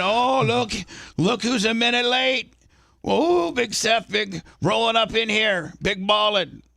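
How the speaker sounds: high, playful ribbing over a minute late; jovial teasing. Shown as a demonstration. Boss teasing his late cohost in a high, playful voice. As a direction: rib him like a buddy, all fun. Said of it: "me being jovial about you being late".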